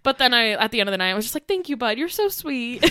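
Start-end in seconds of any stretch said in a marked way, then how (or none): 1.5-2.8 higher, cooing gratitude; tender thanks to child. Retold or imitated. Bride thanking a boy at night's end, tender. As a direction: coo it sweetly to a little one.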